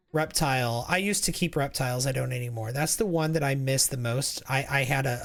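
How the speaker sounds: usual speaking voice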